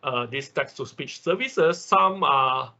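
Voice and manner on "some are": ordinary talk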